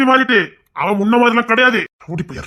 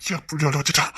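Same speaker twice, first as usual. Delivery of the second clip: first take low and softer; quiet hurt muttering; soft grievance; 4 semitones lower, 7 dB quieter than usual. Acted. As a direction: murmur it, hurt.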